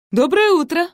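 high, loud, perky hello; brisk cheerful greeting. A narrator's reading. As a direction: greet everyone with caffeinated pep. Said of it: "бойко поздоровалась она".

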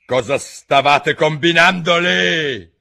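high, loud and slow, accusing; stern interrogation. Acted. La regina interroga i giardinieri, severa. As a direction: demand an answer sternly.

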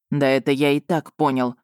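lightly voiced werewolf, a touch impatient; hint of an impatient exclaim. A narrator's reading. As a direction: toss it off with mild impatience. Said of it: "нетерпеливо воскликнул полкан".

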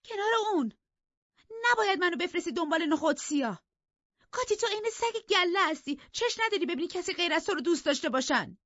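very high, fast, heated accusing voice; flushed with anger. Acted. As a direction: fling the accusation at Cathy. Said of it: "برافروخته ادامه داد".

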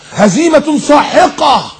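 high, slow, stretched proclamation; mock bombast. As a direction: declaim it like a crushing war bulletin. Mocking war-like football vocabulary. (إلقاء حربي مفخم بسخرية)

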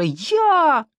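high, drawn wail of a word; stuck on the word, upset; acted stammering distress; tearful stammer. A narrator's reading. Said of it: "заикалась Сима".

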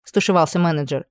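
plain reading voice

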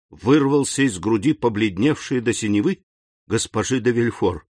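plain reading voice

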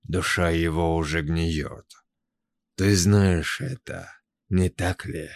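a hint of a hissing creature; lightly voiced slithering whisper; slow hypnotic serpent hiss. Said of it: "словно змеиный шёпот".

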